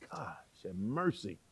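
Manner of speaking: soft unvoiced plea; whispered prayer. Real, not acted. Preacher aside to God after Saul's abuse, whispered. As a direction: breathe it as a private prayer.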